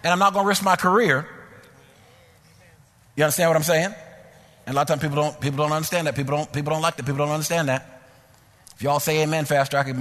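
usual speaking voice

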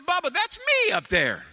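put-on very high, loud little boy crying out; preacher imitating his grandson's delighted yell; excited toddler shout. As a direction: shout it like a thrilled three-year-old. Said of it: "He said".

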